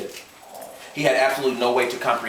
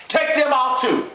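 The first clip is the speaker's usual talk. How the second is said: angry order; 4 dB louder, slower than usual. A narrator's reading. Gus ordering.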